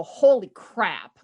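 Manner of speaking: slow, stunned exclamation; shocked realization. Retold or imitated. A leader seeing their own feedback, slowly shocked. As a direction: let it land slowly, shaken. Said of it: "look in the mirror and go".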